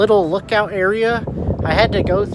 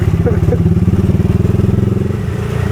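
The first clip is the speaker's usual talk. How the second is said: breathy laugh; 4 semitones higher, 5 dB louder than usual. Real, not acted. Tight squeeze through alley.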